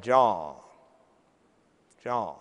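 low, slow Southern drawl, lightly imitated; easygoing Southern drawl, low and unhurried. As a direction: draw out the name like a Georgia CEO. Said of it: "In his strong Southern drawl".

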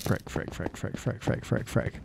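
panicked quiet muttering; frantic breathless repeats while fleeing; being chased. Real, not acted. Fleeing the witch in game.

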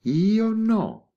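high, loud, stubborn protest; an old lady firmly refusing to give way; defiant cry. Acted. Old lady defiantly contradicts commissario.

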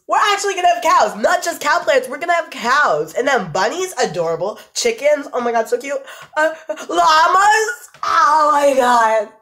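ordinary delivery

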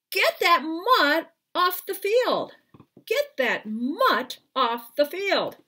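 high, loud, slow yell at the dog; angry yell. A narrator's reading. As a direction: shout him off the field, furious. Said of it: "A player from the other team yelled".

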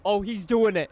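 ordinary talk, nothing marked

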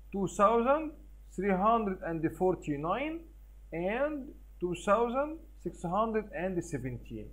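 put-on very slow, each number spelled out for children; slow number reading. Shown as a demonstration. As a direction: read the numbers slowly for the class. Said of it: "إزاي نعمل compare".

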